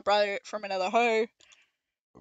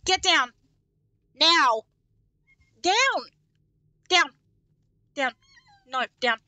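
stern commands to dog; 6 semitones higher, 7 dB louder than usual. Real, not acted. Scolding the dog on the desk.

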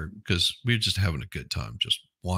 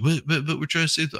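higher, fast flustered protest; mock activist stammer; 8 semitones higher, faster than usual. Mocking the activist's protest, high, fast and flustered. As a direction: sputter it like a whiny kid. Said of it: "I was like".

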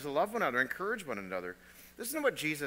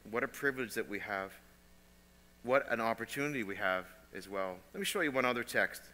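ordinary talk, nothing marked

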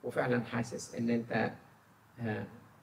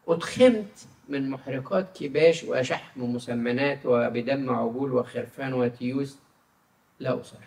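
slow, louder and raised reading of God's words; solemn scripture reading; 5 dB louder, slower than usual. Acted. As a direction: proclaim the verse slowly, weightily. Reads Isaiah aloud in sermon. (قراءة كتابية مهيبة ببطء)

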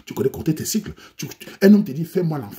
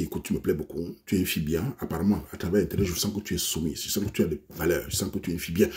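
low, soft sweet-talking charmer; lowered, softer voice of a sweet-talking man; smooth seducer; 7 semitones lower, 6 dB quieter than usual. Acted. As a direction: purr it like a smooth online seducer.

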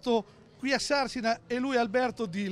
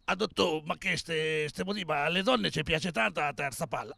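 lightly voiced deep-voiced patient pleading; a hint of a booming voice begging the doctor; big-voiced patient; 7 semitones lower than usual. Joke patient begging the doctor for a normal voice, mock big voice. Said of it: "aveva questo vocione".

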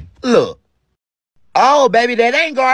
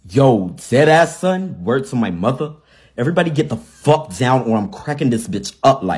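put-on New York accent; 6 semitones lower than usual. Robber threatening a bank in a New York accent. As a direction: threaten the room like a New York stick-up kid. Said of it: "you sound like you from New York".

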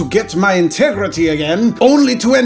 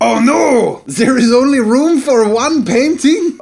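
put-on high, loud French-accented wail; hammed-up anguished complaint; mock dismay; 6 semitones higher, 4 dB louder than usual. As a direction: whine it out in theatrical distress. Dismay at only one space.

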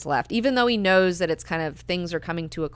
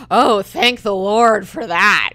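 sarcastic mock piety; 2 semitones higher, 10 dB louder, slower than usual. Alia mocking Korba's piety.

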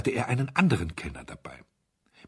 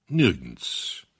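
a lower, solemn bass voice for Mister Audley; deep bass agreement; 4 semitones lower than usual. Acted. Mister Audley agreeing with the duke in a low solemn bass. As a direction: rumble it low like a gravely serious old gentleman. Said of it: "in tiefem Bass".

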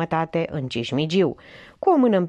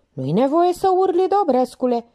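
much higher, louder snappish reproach; Minerva, testy; 10 semitones higher, 5 dB louder than usual. Acted. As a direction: cut him off for shouting. Minerva rebukes the shout.

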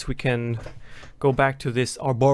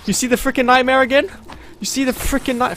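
loud, higher panicked cries; panicked exasperation; 7 semitones higher, 7 dB louder than usual. Real, not acted. Gamer sees the nightmare, panicked and loud. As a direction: dread rising into yelling.

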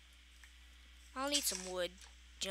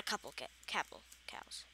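whisper; 6 semitones lower, 13 dB quieter than usual. Real, not acted. Muttered correction to himself.

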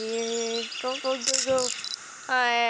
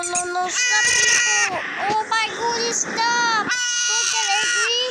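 high, loud pleading; distressed yelling; 5 semitones higher, 4 dB louder than usual. Real, not acted. Player pleads with fighting game figures, distressed yelling. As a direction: yell for them to stop.

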